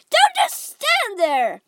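high, loud, drawn-out shriek; wife screaming at her husband. A narrator's reading. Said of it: "Missus Gloop screamed at Mister Gloop".

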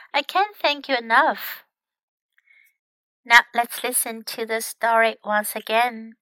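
usual speaking voice

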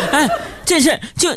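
words broken by laughter; laughing. Real, not acted. Host still laughing at the listener's message. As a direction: talk through the laugh.

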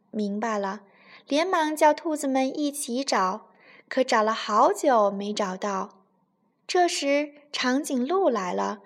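usual speaking voice

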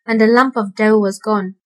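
plain reading voice

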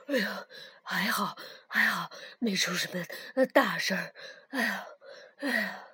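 panting between words; breathless from the rescue; out of breath. Acted. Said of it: "累得气喘吁吁".

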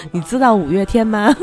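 coaxing Taiwanese tout, laughing; Taiwanese accent. Pretend. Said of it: "拉大陆游客的那种".

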